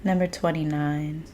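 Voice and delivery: low, hushed, calm voice; soft meditative opening. Acted. Host opening a live session with gratitude, soft and meditative.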